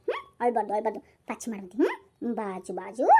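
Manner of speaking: low, soft hushing; tender bedtime murmur, pitched low; soothing. Acted. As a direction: comfort a crying child back to sleep. Soothing a crying child to sleep.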